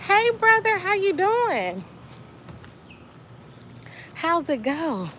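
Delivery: high-pitched, drawn-out hello; calling out greeting. Real, not acted. Calls out greeting to brother.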